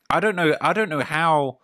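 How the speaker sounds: normal talking voice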